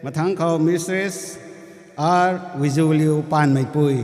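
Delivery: formal name announcement; calling out names. Real, not acted.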